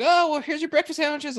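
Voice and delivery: imitating the barista handing over sandwiches; SoCal barista voice. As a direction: chirp it like a bubbly barista. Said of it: "she sounds very SoCal".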